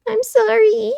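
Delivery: very high, loud crying sorry; shrill loud sobbing apology; loud tearful apology. A narrator's reading. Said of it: "Say it louder".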